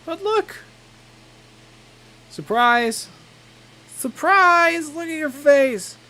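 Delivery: excited surprise. Acted. Acted surprise party prank.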